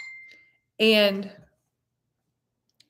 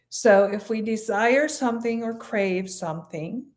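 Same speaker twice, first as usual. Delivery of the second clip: ordinary delivery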